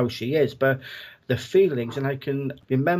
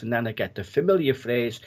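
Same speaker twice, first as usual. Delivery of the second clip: normal talking voice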